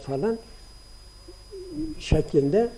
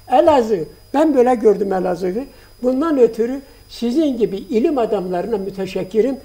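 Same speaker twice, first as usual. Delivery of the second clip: moved, rising praise; 3 semitones higher, 5 dB louder than usual. Real, not acted. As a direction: swell with emotion as you praise. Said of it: "Bunu duygulanarak söylüyorum".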